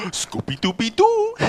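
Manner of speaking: high cartoon-dog howl; Scooby Doo. Comic mimicking a cartoon dog after snack joke, howled catchphrase.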